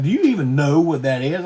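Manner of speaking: imitating a loud condescending question; retold challenge. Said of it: "he's like".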